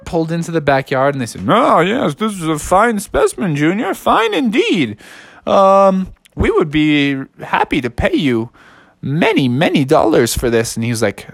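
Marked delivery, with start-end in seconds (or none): 1.5-10.7 high, swooping connoisseur voice; storybook museum official, approving; pompous museum expert. Acted. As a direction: appraise it in a high, affected voice. Plays the dinosaur museum buyers.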